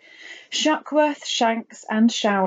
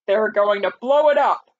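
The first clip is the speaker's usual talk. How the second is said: army chief's urgent cry; 4 dB louder than usual. A narrator's reading. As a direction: exclaim it in character as an alarmed general. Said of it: "cried the chief of the army".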